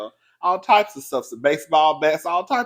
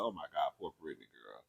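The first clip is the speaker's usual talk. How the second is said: low, quick, quiet pity; pitying murmur; 12 semitones lower, 18 dB quieter than usual. Retold or imitated. Talking to her sister, low pitying murmur. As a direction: mutter it low like you can't believe it. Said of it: "I said".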